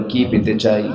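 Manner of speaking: ordinary delivery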